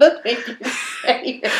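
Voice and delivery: real laughter breaking into words; laughing. Real, not acted. Friend teasing co-host over a tricky title, laughing. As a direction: crack up while talking. Cracking up at a mispronunciation.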